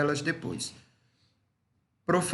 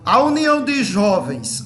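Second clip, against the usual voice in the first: raised, higher announcing voice; calling out; 4 semitones higher, 7 dB louder than usual. Real, not acted. Leader calls the youth group's item, calling out.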